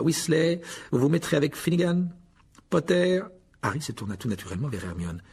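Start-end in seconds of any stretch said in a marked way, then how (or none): 0.0-3.2 higher-pitched, sneering orders; name each pupil with disdain; mocking teacher. A narrator's reading. Rogue assigns partners to the boys, mocking and curt.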